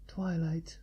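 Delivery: soft, hushed reply of her name; said softly. Acted. Ark answering Twilight, softly. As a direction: answer her, low and tender. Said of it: "Ark said softly".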